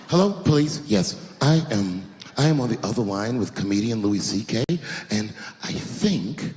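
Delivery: a low, measured caller voice tattling on Louis C K; mock police call. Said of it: "Call the police on him".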